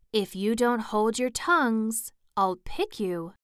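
ordinary delivery

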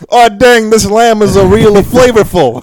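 high, loud, slow fake Greek accent; mock Greek accent. Host cheering the lamb, in a loud mock Greek accent. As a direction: shout it in a silly hammed-up Greek accent. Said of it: "in your best Greek accent".

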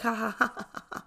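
imitated laugh, trailing away softly. Embarrassed applicant in front of restaurant staff, mock laugh fading. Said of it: "So I'm gonna laugh it off".

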